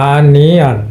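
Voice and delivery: আনিয়ান with short open a, "AH-ni-an"; correct pronunciation. Shown as a demonstration. Teacher models the right form for students, correct pronunciation. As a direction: stress the opening আ of আনিয়ান, schwa at the end. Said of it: "correct pronunciation হবে".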